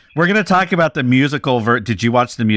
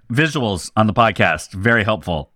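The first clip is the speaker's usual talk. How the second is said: normal talking voice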